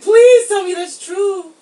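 loud, slow, high mock begging; desperate plea. Podcaster retelling hopes about a departure, pleading. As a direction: beg loudly, drawing the words out. Retold reaction to welcome news.

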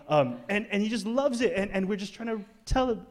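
normal talking voice

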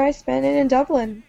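ordinary talk, nothing marked

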